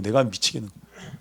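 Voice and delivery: weary sigh. Acted. A leader crushed by complaints, quiet weary repeat. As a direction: let it sink down, worn out.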